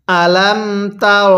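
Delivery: slow, measured "আলাম তার"; model recitation. Shown as a demonstration. Teacher has learners read along, slow model recitation of "আলাম তার". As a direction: recite "আলাম তার" with drawn-out syllables for repeating. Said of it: "আমার সাথে সাথে পড়বেন".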